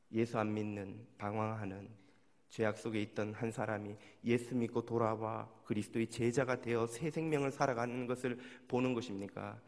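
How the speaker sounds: usual speaking voice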